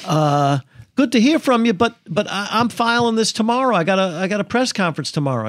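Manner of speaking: half-hearted imitation of a country lawyer with an Arkansas accent. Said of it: "with an Arkansas accent that I can't do".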